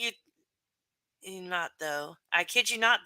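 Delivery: ordinary talk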